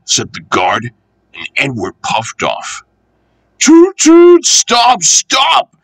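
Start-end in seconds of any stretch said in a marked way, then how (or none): 3.6-4.4 high loud horn toots. Acted. Bertie the bus hooting to stop the train. 4.4-5.8 high wailing cry of stop; wailing shout. Acted. Bertie the bus wailing after the train. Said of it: "wailed Bertie".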